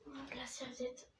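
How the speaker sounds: usual speaking voice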